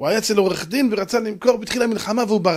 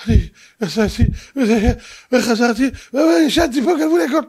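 mock sobbing; 2 semitones higher than usual. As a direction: sob out the tale, pitch rising toward the end. Robbed man tells his tale. (מספר בבכי מעושה)

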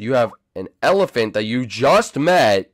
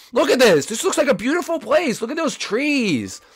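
very high, squeaky disbelief; high-pitched comic insistence; incredulous protest; 9 semitones higher than usual. Real, not acted. Incredulous defence of the place.

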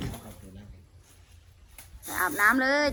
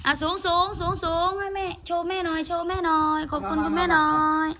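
coaxing command; 4 semitones higher than usual. Real, not acted. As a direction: coax the elephant in a raised, singsong call. Coaxing elephant to perform commands. (ร้องสั่งช้างเสียงสูง ลากเสียง)

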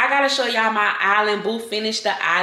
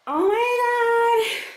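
excited squeal; much slower than usual. Real, not acted. Gushing over the closet island.

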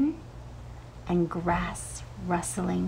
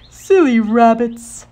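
scolding owl; 4 semitones higher, 13 dB louder, slower than usual. Acted.

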